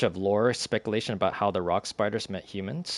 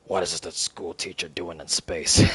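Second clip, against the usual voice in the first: trying a lower voice; 4 semitones lower than usual. Retold or imitated. Grace testing voices for Rocky, lower put-on voice. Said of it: "trying to go through various voices".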